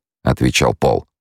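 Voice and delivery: ordinary delivery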